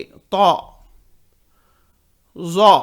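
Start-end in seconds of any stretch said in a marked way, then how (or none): 0.0-0.5 तो said thick and rounded, not a thin ता; heavy Ta. Shown as a demonstration. Teacher showing students the heavy तो, not a thin ता. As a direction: make तो heavy and full. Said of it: "तो मोटा पढ़ेंगे".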